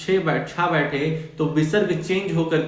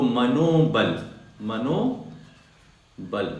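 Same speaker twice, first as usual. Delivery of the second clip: slow demo; 4 semitones lower, much slower than usual. Shown as a demonstration. Teacher slowly spelling out मनोबल for the class. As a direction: stretch मनोबल out so it sinks in. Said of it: "teacher demonstrates joined word".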